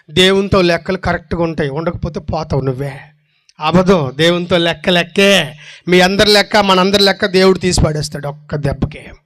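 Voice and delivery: ordinary talk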